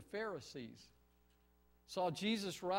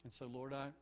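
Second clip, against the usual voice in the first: soft, low earnest prayer; hushed pastoral prayer; 6 semitones lower, 8 dB quieter than usual. Acted. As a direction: pray quietly to the Father. Leads the congregation in prayer.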